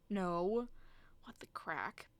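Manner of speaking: usual speaking voice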